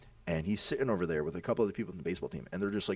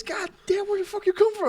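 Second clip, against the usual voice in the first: very high, loud squealed protest; imitated pained outcry; 7 dB louder than usual. Stepped-on friend to the runner, imitated pained outcry. Acting out startled hurt friend.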